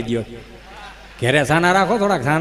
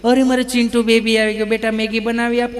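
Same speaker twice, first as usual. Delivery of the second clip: put-on coaxing sing-out to a little child, slightly raised pitch; doting mother; 4 semitones higher than usual. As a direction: coo like an indulgent mum. (લાડ લડાવતી mummy)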